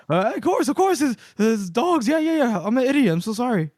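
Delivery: high-pitched, hurried mock apology; flustered backpedalling. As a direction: cave in and grovel in a high voice. Retold flustered backing down, apologising.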